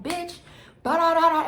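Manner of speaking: high, loud mocking babble of a shouting girl; mock her ranting in your face; imitating her yelling. Mimicking the girl yelling in her face, high and loud. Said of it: "she was talking wild".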